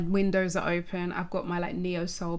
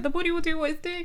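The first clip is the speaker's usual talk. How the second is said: carefree; 9 semitones higher, faster than usual. Retold or imitated. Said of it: "I was kinda just like".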